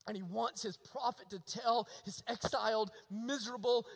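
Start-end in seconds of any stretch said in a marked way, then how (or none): none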